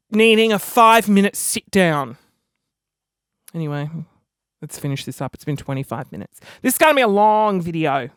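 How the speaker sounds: ordinary talk, nothing marked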